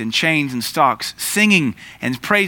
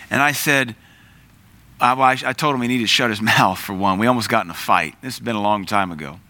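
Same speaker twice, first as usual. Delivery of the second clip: ordinary talk, nothing marked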